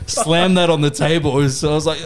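ordinary talk

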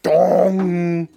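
high, loud mock clang; imitated dong. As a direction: ring out like a struck bell. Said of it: "be like".

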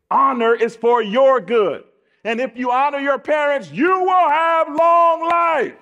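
high, loud, drawn-out proclamation; preaching climax. Acted. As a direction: build to a stretched-out pulpit shout.

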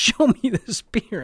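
high, loud and slow demand; imitating wife demanding to see the beer; mock demanding wife. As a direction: play the wife calling his bluff.

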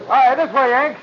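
high, loud call to someone outside; cheerful loud hail from the compartment. Acted. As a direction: beckon him over with a shout. Waving the American officer over.

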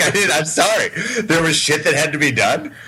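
lightly voiced sheepish excuse; mock excuse voice. He's like, mocking the co-host.

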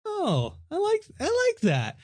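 high delighted squeal; sudden high delight. Real, not acted. Delighted reaction to synopsis.

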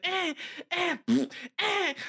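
put-on high baby grunts and a raspberry; imitated baby fussing. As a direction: mimic a frustrated baby in its sleep. Imitating fussing sleeping babies.

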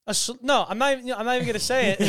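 protesting refusal. Real, not acted. Refusing the drinking-game trap.

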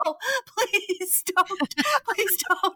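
high, pleading mock panic; freaking out. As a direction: beg the driver, half laughing.

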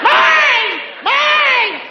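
high, loud shrieking "Mine!"; screaming toddler. Retold or imitated. Toddler screaming at siblings over a toy, shrill.

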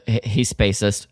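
ordinary talk

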